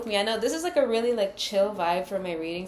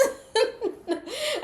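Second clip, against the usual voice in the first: very high giggle; laughing; 13 semitones higher, 4 dB quieter than usual. Real, not acted. Reader amused by own put-on accent, high giggling. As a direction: dissolve into high, squeaky laughter.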